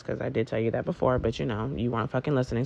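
muttered aside. Real, not acted. Aside muttered under her breath.

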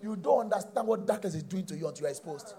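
ordinary delivery

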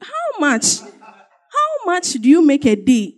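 mock astonished how much; incredulous challenge. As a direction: needle him with a slow disbelieving question. Challenging the man.

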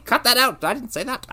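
ordinary delivery

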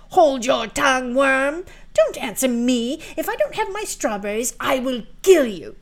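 loud, high furious threat; angry stepsister. Acted. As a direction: scream threats at her.

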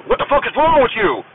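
high-pitched imitation of an angry yell; screamed and hollered. Rescue swimmer berating a flailing recruit in the pool, imitated high holler. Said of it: "screamed and hollered".

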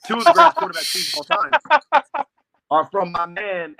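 fired-up defense of the Manning brothers; excited high outburst. Real, not acted.

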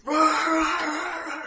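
high raging roar; roaring grunting brute. Acted. Barbarian jumping and raging.

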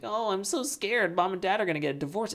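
high-pitched mock-worried child; scared kid. Friend's kid overhearing parents argue, mock scared. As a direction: play a fretting kid, quick and high. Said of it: "Imagine that kid being like".

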